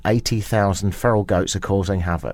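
low, loud breaking-news headline voice; news anchor. Acted. Host imagining leading the bulletin with goats, newsreader voice. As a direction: announce it like the top story tonight. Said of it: "Would you lead with that?".

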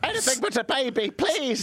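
high-pitched, hammed-up begging; mock panicked plea. Host as the desperate man, mock panicked plea. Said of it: "he was like".

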